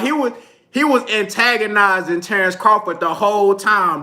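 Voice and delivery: usual speaking voice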